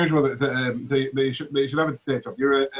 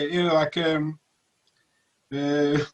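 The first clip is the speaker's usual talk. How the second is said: normal talking voice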